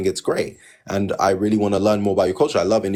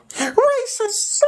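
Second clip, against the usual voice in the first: very high, squeaky mock accusation; tiny high-pitched mocking voice; mocking squeal. Mocks the accusation of racism.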